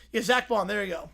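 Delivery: ordinary talk, nothing marked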